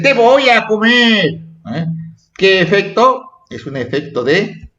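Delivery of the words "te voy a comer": "Te voy a comer" exclaimed high, as a threat; exclamation. Shown as a demonstration. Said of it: "Qué efecto producen el signo de interrogación y admiración". ("Te voy a comer" exclamado con tono agudo)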